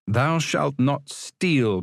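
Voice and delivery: slow, measured commandment; solemn recital. Acted. Samuel remembering the commandment on the wall, solemn. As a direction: intone it like Sunday school.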